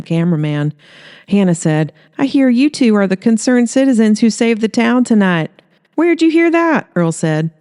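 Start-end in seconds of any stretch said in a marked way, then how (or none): 2.2-5.5 bright, raised reporter voice; upbeat news-reporter delivery; TV reporter Hannah. Acted. 5.9-6.9 high, startled question; surprised Earl. Acted.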